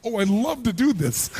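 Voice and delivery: a lightly voiced gushing woman; lightly mocking a woman who loves makeup; mock delight. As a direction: tease with a hint of fake glee. Mocking women who love makeup.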